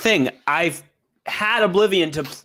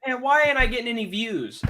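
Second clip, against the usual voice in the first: whiny youtuber; 7 semitones higher than usual. Retold or imitated. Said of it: "These type of people are like".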